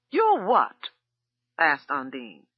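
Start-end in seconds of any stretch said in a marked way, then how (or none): 0.1-0.9 high, loud incredulous snap; outraged disbelief; indignant disbelief. A narrator's reading. Cook told she's fired, loud disbelief.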